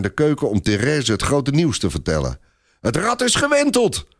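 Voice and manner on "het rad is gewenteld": put-on very high, elated child cry; high-pitched jubilant little boy; excited boy calling out. A narrator's reading. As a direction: call the great news to your friend. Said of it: "roept hij zijn vriendinnetje toe".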